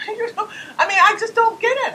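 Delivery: high laughing exasperation; real laugh of bafflement; laughing disbelief. Real, not acted.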